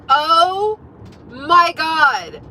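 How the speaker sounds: loud, drawn-out dismay; mortified exclamation. Retold or imitated. Teller reliving toilet paper pulled from her jeans, loudly mortified. Acts out embarrassment at being exposed.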